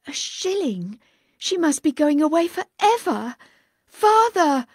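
building to a high, loud cry. Acted. Girl crying out to her departing father, loud. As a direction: call after him in panic. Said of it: "cried Fenella".

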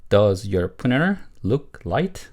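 slow, word-by-word model question; model reading. Shown as a demonstration. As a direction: read it slowly for learners to repeat. Teacher models sentence for repetition. (천천히 또박또박 읽는 시범)

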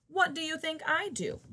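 plain reading voice

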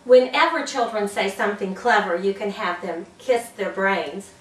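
ordinary talk

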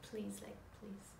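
breathy whisper of please; hushed please. Shown as a demonstration. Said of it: "Please don't say merci".

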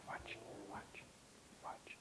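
breathy whispered cue, barely audible. Real, not acted. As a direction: whisper so the dog stays focused. Quiet cue while dog works.

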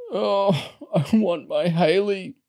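high, plaintive wail; moaning. A narrator's reading. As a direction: moan pitifully for a loved one. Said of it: "Mister Large moaned".

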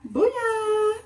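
high, loud whoop of triumph; triumphant cheer. Real, not acted. Vlogger cheering at her finished hair wrap, loud and high. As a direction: cheer like you just nailed it.

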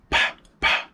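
a quick whispered burst of mouth gunshots; imitated gunshots. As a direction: pop the gunfire out with your lips.

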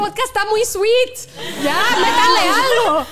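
risa aguda, lenta y más fuerte; riendo. Real, not acted. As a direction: lo pide entre carcajadas. Pide preguntas picantes riendo. (pidiendo a los presentadores más picante, entre risas)

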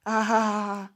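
a loud, mocking villain's "ah ah ah"; gloating villain laugh; villain cackle. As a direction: cackle like the bad guy has arrived. The villain is here.

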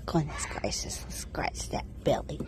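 soft whisper to the goat; whispered coo while scratching a goat's belly. Real, not acted. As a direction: whisper it tenderly.

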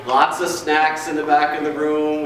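normal talking voice

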